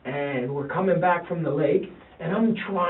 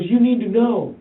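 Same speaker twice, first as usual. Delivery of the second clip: higher and louder, urgent insistence; insistent son; 8 semitones higher, 10 dB louder than usual. Retold or imitated. Son to his dad, urgent and louder. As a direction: press it on him, urgent.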